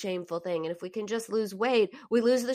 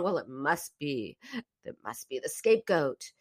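mock blame; 4 semitones lower than usual. Mock family blaming scapegoat.

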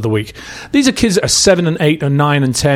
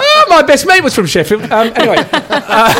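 laughing. Real, not acted. Host tells the guest about his best mate, laughing. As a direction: giggle the words out, delighted.

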